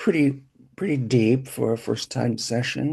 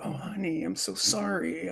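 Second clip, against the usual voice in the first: tender mother; 8 semitones higher, 8 dB quieter than usual. Retold or imitated. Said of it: "cares and go".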